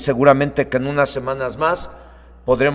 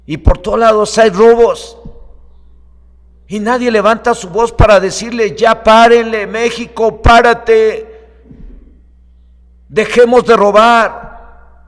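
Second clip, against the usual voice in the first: loud, higher-pitched preacher's appeal; raised voice; 7 semitones higher, 7 dB louder than usual. Real, not acted. Preacher urging Mexico to stop stealing, raised voice. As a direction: call out to the nation to stop. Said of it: "hoy levanto mi voz".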